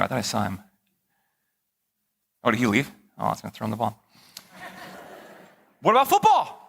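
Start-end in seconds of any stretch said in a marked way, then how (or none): 5.8-6.6 calling out. Real, not acted. Calls out a question to the congregation.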